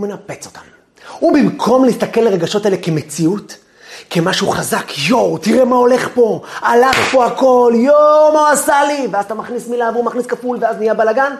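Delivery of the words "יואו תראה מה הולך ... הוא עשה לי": loud, rising indignant yell; worked-up cry of outrage; agitated outburst. Pretend. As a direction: blow up at whoever provoked you. Voicing an agitated self in conflict.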